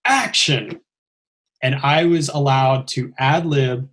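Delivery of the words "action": imitating a director's cue; a retold set command; director's call. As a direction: call the start of a take. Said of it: "I start hearing all the commands".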